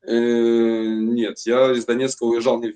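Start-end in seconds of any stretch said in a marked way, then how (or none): none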